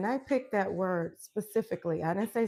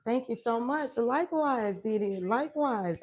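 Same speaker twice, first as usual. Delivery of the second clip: normal talking voice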